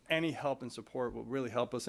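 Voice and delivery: ordinary talk, nothing marked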